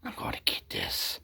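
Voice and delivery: hushed plotting whisper; scheming whisper. Retold or imitated. Wife plotting against her husband, whispered. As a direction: whisper it like a sly conspirator. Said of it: "she was like".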